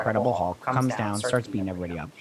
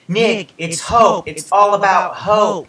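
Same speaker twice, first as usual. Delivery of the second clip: slow, higher and louder, a menacing villain; ominous villain taunt; 6 semitones higher, 5 dB louder, slower than usual. Retold or imitated. Recapping the show, she voices the caller's threat to Nick in a slow, louder, menacing villain tone. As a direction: drag out the threat like a movie baddie.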